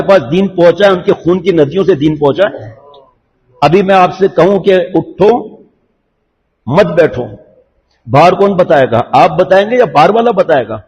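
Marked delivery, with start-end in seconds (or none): 4.9-7.2 اٹھو on its own, a long pause, then مت بیٹھو together; pause after اٹھو. Shown as a demonstration. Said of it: "غصے سے کہا تھا یا پیار سے کہا تھا". (اٹھو کے بعد وقفہ، پھر مت بیٹھو)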